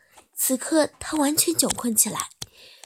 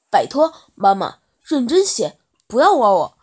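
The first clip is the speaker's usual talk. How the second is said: embarrassed protest; 10 dB louder than usual. Acted. Son refusing his mother's date offer, loud and flustered. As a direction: shout it off, mortified.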